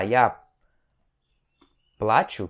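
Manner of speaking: плачу as PLA-chu, first syllable strong; stress on the A. Shown as a demonstration. Teacher shows the form being learned, stressed A in плачу. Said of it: "que é o que a gente tá querendo aprender".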